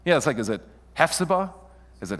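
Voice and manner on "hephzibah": Hephzibah stressed on the first syllable, HEF-suh-buh; offered pronunciation. Shown as a demonstration. Preacher trying out readings with the congregation, Hephzibah as a front-stressed guess. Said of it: "How would you pronounce it?".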